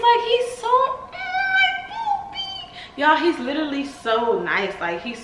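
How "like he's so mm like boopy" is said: gushing over the puppy in a high sing-song; cutesy coo. Real, not acted. Cooing about the cute dog.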